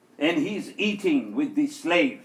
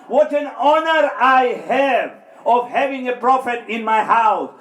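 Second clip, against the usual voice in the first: loud, slowing, rising sermon climax; preaching at full force; 8 semitones higher, 6 dB louder than usual. Acted. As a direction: drive the point home to the congregation.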